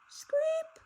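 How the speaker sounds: very high, thin creak, rising; spooky high screech sound; high creaking screep. A narrator's reading. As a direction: squeal it like a creaky floorboard. Monster footsteps sound effect.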